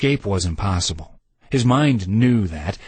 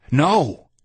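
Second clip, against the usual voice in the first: higher sharp panicked no; cry of alarm; 5 semitones higher than usual. A narrator's reading. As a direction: cry out to stop him. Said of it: "Lloyd cried".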